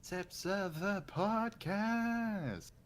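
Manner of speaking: slow, high, hammed-up host voice; podcast host intro. Opening their podcast as a hammed-up presenter. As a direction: draw out the show name like a presenter.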